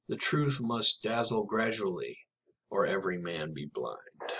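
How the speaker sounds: slow, measured closing lines of a poem; poem reading. Acted. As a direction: let the last lines land slowly.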